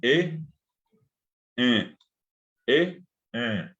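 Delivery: "e" sent through the nose as a nasal vowel; nasal Guaraní "e". Shown as a demonstration. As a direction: hum the "e" nasally. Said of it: "vocales nasales".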